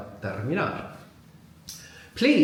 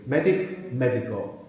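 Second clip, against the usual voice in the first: "Medic" then "médico", both stressed on MED; same word stress. Shown as a demonstration. Teacher showing no stress change, first-syllable stress in "médico". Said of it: "we don't have a change in word stress".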